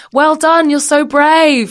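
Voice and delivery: high, loud mock cheer, drawn out on brave; gushing encouragement. Passers-by cheering on the runners, gushing mock encouragement. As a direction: imitate an over-encouraging passer-by.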